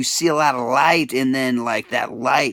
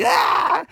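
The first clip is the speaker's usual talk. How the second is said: crying out; 13 semitones higher than usual. Real, not acted. Crying out for love.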